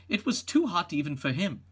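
plain reading voice

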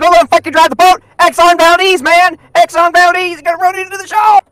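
high, fast, loud hollering; full-volume high-pitched alarm; frantic shouting. Pretend. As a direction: yell a panicked warning. Shouts over his own shanty.

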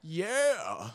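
high, drawn-out rapper ad-lib. Retold or imitated. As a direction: imitate a hype man's catchphrase. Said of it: "[name] did the Deckard voice".

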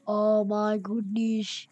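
slow, drawn-out mock-amazed voice; put-on awe. Gamer telling viewers of a find, mock-awed. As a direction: announce the find slowly in a put-on voice.

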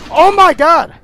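loud, high burst of fright; real jump-scare shout; startled outcry. Real, not acted. Jump scare in horror game.

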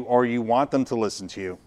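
usual speaking voice

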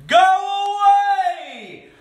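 high, long-drawn shout. A narrator's reading. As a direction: holler it far, stretching the vowels. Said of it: "Holler shouted at the tornado".

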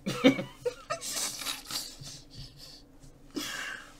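squealing laugh; real laughter after his joke; high-pitched laugh. Real, not acted. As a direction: break into a shrill burst of laughter. Laughing at spotted dick joke.

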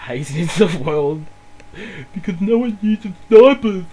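drawn-out, escalating mock tantrum; mock angry. Gamer recounting a day hating the world, mock-angry and building. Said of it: "I was just angry at the world".

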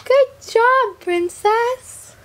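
high, bright praise voice. Real, not acted. Praising baby daughter.

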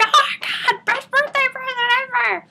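very high re-enacted delight; thrilled squeal. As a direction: squeal it as if just given the gift. Acts out thrilled reaction to gift.

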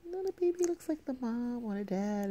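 quoted line. Retold or imitated. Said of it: "I'm like".

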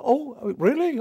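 lightly voiced intrigued Eve. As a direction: gasp it out as a curious Eve. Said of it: "a talking snake who says".

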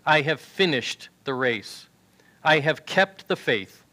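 normal talking voice

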